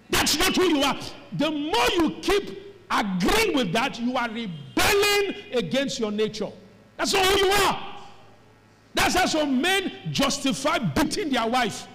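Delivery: high, loud pulpit shouting; full-force preaching. Real, not acted. Preacher rebuking the congregation for rebelling against their nature, shouted at full force.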